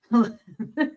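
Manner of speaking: laughing. Real, not acted. Co-host recalls the film's little people, laughing. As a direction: squeeze the words out through real laughter. Cracks up recalling the creepy scene.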